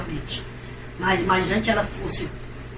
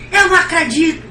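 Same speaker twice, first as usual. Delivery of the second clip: higher, louder cry of disbelief; 9 semitones higher, 8 dB louder than usual. Retold or imitated. Sister hearing the revelation, loud disbelieving cry. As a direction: gasp out that you can't believe it.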